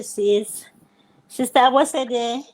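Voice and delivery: ordinary talk, nothing marked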